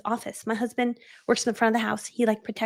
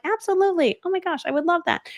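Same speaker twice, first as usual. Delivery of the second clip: gushing yes; 8 semitones higher than usual. Retold or imitated. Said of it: "And you say things like".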